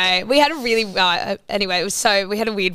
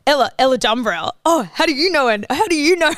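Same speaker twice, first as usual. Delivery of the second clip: higher, theatrical mock awe; hammed-up movie voice; 5 semitones higher, 3 dB louder than usual. Mocking Eddie's story as a movie scene, hammed-up. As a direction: play it like a dramatic film line. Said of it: "out of a movie text card".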